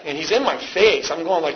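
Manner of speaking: normal talking voice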